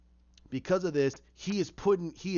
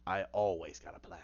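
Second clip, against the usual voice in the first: smug Penguin; 8 semitones lower, 6 dB quieter than usual. Retold or imitated. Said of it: "he goes".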